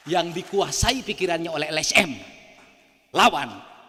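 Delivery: loud, rising call to fight; high intonation. Shown as a demonstration. Speaker demonstrating his line to the doctor, loud and high. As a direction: punch out Lawan as a command. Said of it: "ngasih intonasinya tinggi itu".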